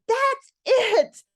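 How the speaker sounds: high, loud, exasperated snap; fed-up outburst. Retold or imitated. Mom at her limit announcing to family, loud exasperated outburst. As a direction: mom reaching her limit and letting it fly. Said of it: "saw that I was very upset".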